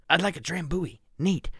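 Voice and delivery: movie quote. Retold or imitated. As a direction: recite the character's line in his voice. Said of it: "I can quote the entirety of".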